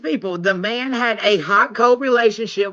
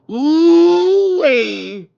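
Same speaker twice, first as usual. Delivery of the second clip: long, high, loud ooh-wee; real astonished holler; stunned whoop; 7 semitones higher, 4 dB louder than usual. Real, not acted. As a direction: draw it out loud and high in amazement. Stunned reaction to what turns up.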